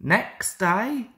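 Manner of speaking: ordinary delivery, nothing marked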